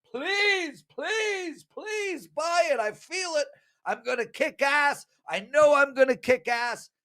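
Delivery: high-pitched, hammed-up begging; pleading. Handicapper on hands begging bettors to buy, high mock pleading. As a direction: plead up high, please after please. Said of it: "And I pleaded".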